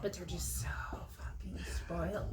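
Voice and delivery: whispered aside. Real, not acted. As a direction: hush it like a guilty confession. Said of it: "whispered aside".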